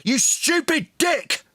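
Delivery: high, slow, angry yell; screamed insult. Real, not acted. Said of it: "Screaming, name-calling".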